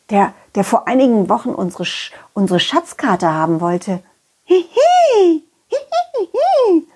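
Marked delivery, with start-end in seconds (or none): 4.4-6.9 squeaky puppet giggles; high giggle. Acted. (hohes quietschendes Kichern)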